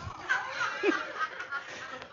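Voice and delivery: a light high giggle. Real, not acted. Pastor giggling at his own joke to the church. Laughs after teasing.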